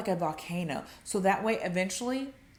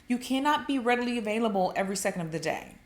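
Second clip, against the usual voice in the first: ordinary delivery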